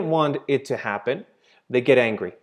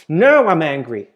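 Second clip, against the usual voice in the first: building to a louder mock-angry snap; demo anger; 3 semitones higher, 6 dB louder than usual. Shown as a demonstration. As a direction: let the anger rise into the second now. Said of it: "Sorry I had to get angry at you".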